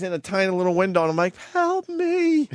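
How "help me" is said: pleading help. Retold or imitated. Mouthing plea through plane window.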